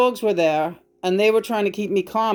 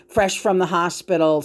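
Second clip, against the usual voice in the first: ordinary talk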